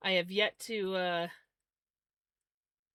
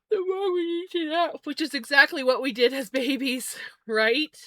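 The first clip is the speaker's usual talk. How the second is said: laughing joke; 7 semitones higher, 8 dB louder than usual. Real, not acted. Joking about naps, laughing.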